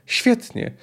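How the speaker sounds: high, exalted exclamation; exalted cry. Acted. The count cries out approval of the assassination plan, high and exalted. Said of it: "krzyknął egzaltowanie hrabia Brown".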